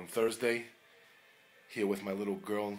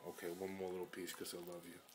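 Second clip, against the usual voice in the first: usual speaking voice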